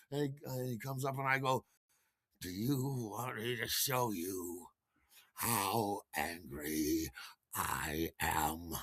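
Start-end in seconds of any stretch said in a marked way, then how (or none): 2.4-8.7 very slow, low and soft; seething anger held down to a slow murmur; quiet menace. Retold or imitated. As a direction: threaten with ominous restraint. Menacing challenge to his friend.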